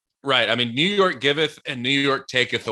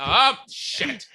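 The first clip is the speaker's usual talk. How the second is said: high, thin "uh, shit"; high flustered curse; 11 semitones higher than usual. Retold or imitated. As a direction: curse under a high, strained voice. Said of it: "You're like".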